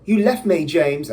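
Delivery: hammed-up British reproach; British accent. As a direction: play a wronged British woman. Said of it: "I don't know why I made her British".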